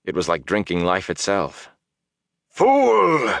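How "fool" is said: high furious roar. Acted. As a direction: bellow it in rage. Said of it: "Baalzamon roared".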